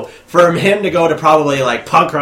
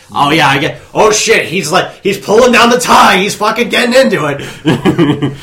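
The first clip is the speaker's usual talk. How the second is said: loud, rising play-by-play of a retold moment; excited reaction; 7 semitones higher, 7 dB louder than usual. Audience member marvels at the singer loosening his tie, excited. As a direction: imitate getting carried away by the show.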